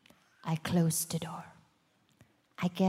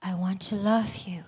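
quiet prayer. Retold or imitated. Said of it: "and I said".

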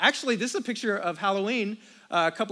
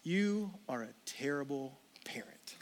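hushed, much softer whisper; 6 semitones lower, 12 dB quieter than usual. Acted. The enemy whispering in a parent's ear, hushed whisper. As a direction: breathe it in his ear like a taunting enemy. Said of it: "the enemy just whispered in my ear".

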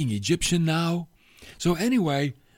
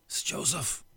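breathless awed whisper; stunned whisper; 8 dB quieter than usual. Acted. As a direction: whisper it as if you can't believe it. Stunned brothers realise the truth.

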